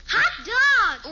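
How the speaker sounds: high, loud cheer of delight; excited whoop; delighted exclamation. Acted. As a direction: jump at the chance with glee. Girl delighted at doubles game.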